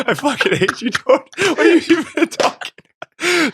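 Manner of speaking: squeaky high laughing outburst; laughing protest. Real, not acted. Laughing at friend's joke.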